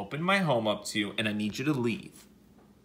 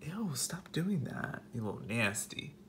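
breathy whisper of disgust; 10 dB quieter than usual. Real, not acted. Whispering at the wasp up close, disgusted. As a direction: hush it, grossed out.

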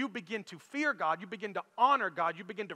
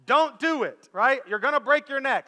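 louder, mildly hammed-up parental warning; alarmed parent; 4 semitones higher, 6 dB louder than usual. Dad lecturing his daughter about the seat belt, alarmed parent act. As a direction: warn like an overdramatic parent. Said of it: "I did the whole parent thing".

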